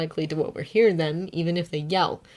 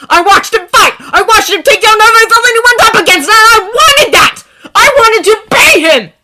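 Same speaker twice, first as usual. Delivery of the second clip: angry; 13 semitones higher, 16 dB louder than usual. A narrator's reading. Bakugo shouting.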